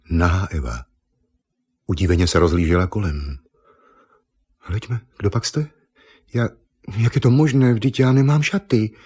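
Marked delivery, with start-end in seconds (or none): 4.6-9.0 lehce zahraná dívka, vyšším hlasem; překvapená dívka. A narrator's reading. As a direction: play a mildly startled young woman, pitched up. Překvapená Eva v příběhu. (lehce překvapený dívčí hlas)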